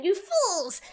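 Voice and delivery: high, loud triumphant villain voice; shouted, high-pitched mad gloating; gloating Boggle. Acted. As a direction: crow over the stolen prize. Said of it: "Boggle kind of looks at it".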